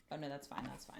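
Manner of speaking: ordinary talk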